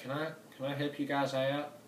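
high, soft Southern lady voice asking to help; polite Southern country woman. Pretend. Vlogger mimicking fans offering co-op help in polite Southern woman voice. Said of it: "That was a weird accent".

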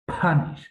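aspirated "p-HA-nish" for Punish; puff of air. Shown as a demonstration. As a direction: blow the P of Punish out. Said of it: "দেখবেন একটা বাতাসে একটা".

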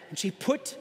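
ordinary talk, nothing marked

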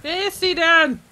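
höher und lauter, betont dramatisch; gespielt dramatisch. Real, not acted. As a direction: die Frage laut und theatralisch stellen. Wiederholt die Frage dramatisch. (laut und höher, gespielt dramatische Frage)

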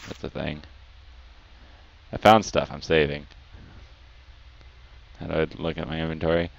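normal talking voice

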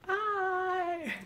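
high-pitched creepy character greeting. Acted. Imitating the creepy demon character they drew, high creepy voice. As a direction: squeal a creepy, drawn-out hi. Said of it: "she was being creepy as well".

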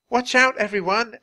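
high, loud call to a crowd; warning cry. A narrator's reading. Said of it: "Annie cried".

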